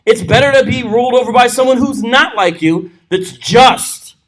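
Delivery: ordinary delivery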